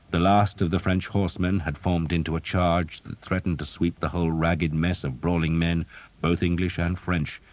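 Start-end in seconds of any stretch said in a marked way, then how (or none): none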